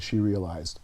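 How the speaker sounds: ordinary delivery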